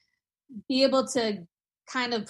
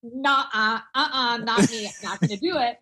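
laughing; 5 semitones higher, 7 dB louder than usual. Retold or imitated. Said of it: "And I said".

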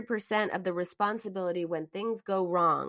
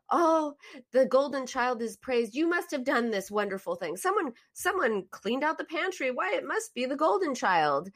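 mock praise; 6 semitones higher than usual. Mock family praising golden child.

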